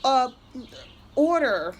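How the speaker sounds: high, breezy dismissive voice; mock company rep. Imitating the company rep excusing the charge, high and breezy. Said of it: "They were like".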